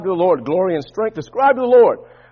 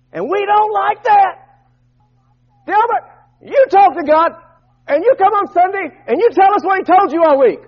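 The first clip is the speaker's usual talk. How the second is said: high, insistent pulpit voice; raised preacher's challenge; full-force preaching; 12 semitones higher, 7 dB louder than usual. Acted. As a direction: drive it home to the congregation, high-pitched.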